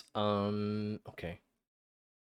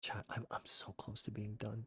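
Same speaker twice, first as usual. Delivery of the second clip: whispering; 6 dB quieter than usual. Real, not acted. Pleading whisper to chat.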